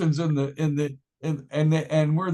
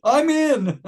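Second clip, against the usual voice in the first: higher and louder, jumping at the offer; excited acceptance, higher and louder; eager yes; 8 semitones higher, 4 dB louder than usual. Retold or imitated. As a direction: burst out with a delighted yes.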